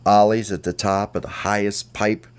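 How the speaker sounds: ordinary delivery, nothing marked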